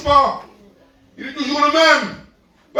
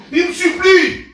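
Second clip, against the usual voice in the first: emphatic; 6 semitones higher, 4 dB louder, slower than usual. Acted. Preacher stresses the begging.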